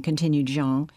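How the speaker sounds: usual speaking voice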